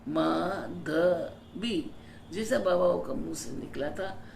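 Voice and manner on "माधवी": माधवी spelled out slowly by sound; syllable-by-syllable name. Shown as a demonstration. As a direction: say माधवी slowly as maa-dha-bee. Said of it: "तो मेरा नाम माधुरी नहीं है".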